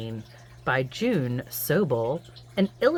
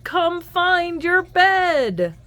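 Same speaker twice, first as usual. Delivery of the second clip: higher, louder wake-up call, slow at the end; 7 dB louder, slower than usual. Acted. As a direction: rouse sleepy reindeer. Calling to wake everyone.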